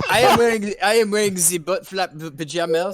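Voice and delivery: put-on comic French accent, amused; playful mock-French delivery. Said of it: "but in a French accent".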